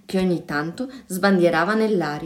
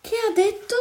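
put-on very high-pitched child's question; squeaked bewildered question, acted; baffled cry; 14 semitones higher than usual. A narrator's reading. Children asking what the giant said, high baffled cry. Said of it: "gridarono i bambini".

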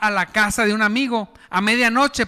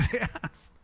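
normal talking voice

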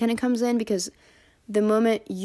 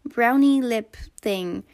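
high, loud, sugary mock influencer voice, slowing at the end; cutesy Hailey Bieber; 5 semitones higher, 5 dB louder than usual. Mocking Hailey Bieber showing her makeup on TikTok, high sugary gush. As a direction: gush sweetly about your cute little look. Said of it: "she was like".

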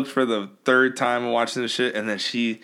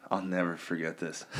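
quiet aside; 5 semitones lower, 10 dB quieter than usual. Real, not acted. Quiet aside before quote.

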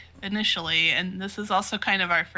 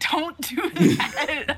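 laughing plea; 8 semitones higher, much slower than usual. Acted. Pleading with cryptic figure.

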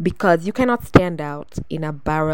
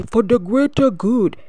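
mocking slogan; 5 semitones higher, 4 dB louder than usual.

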